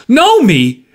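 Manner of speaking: high, incredulous cry; cried out. A narrator's reading. Markheim challenging the visitor's claim, crying out. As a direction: throw it back, pitch leaping up. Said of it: "cried Markham".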